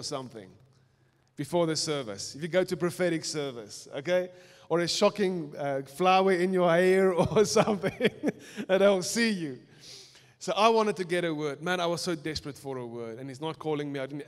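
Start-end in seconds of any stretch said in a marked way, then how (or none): 4.7-9.6 laughing. Real, not acted. Joking about getting noticed.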